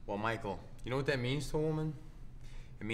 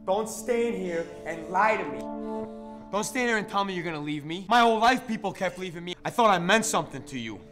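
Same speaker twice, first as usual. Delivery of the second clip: loud, wounded accusation; hurt and angry; 7 dB louder than usual. Acted. Abandoned man to former cellmate who's leaving, hurt anger. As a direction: plead through anger at being abandoned. Wounded plea to departing lover.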